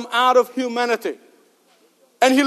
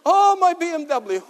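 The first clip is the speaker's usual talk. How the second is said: crying out; 7 semitones higher than usual. Retold or imitated. Hurt yuppie on the ground wailing for his car, hammed-up lament. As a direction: moan like a man mourning his BMW. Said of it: "he was crying out".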